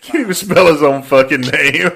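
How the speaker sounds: loud, cracking up; real laughing disbelief. Real, not acted. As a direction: blurt it out through helpless laughter. Cracking up at the played call.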